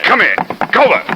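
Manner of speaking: shouting across the lawn at the running man; calling out. Acted. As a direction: yell his name to stop him.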